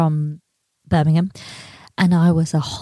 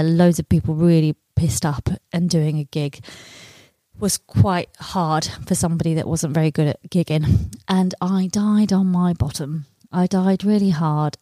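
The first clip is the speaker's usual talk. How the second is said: ordinary talk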